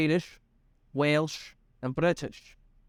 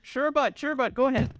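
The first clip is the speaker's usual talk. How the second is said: much higher, hammed-up Canadian "Sure, bud"; a jokey Canadian agreeing; Canadian accent; 8 semitones higher than usual. Dropping the bit in a mock Canadian accent. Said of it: "kill the accents".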